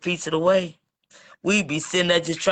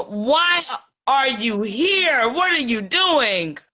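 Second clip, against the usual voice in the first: exasperated mock demand, drawn out and higher; 5 semitones higher, much slower than usual. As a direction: stretch each word out, raised pitch, fed up. Acted exasperated question to EJ.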